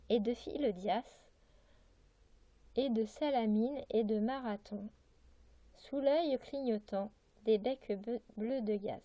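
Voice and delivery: measured, projected poem reading; poetry recitation. Acted. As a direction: recite the verse line by line, clearly articulated. Reads a poem aloud.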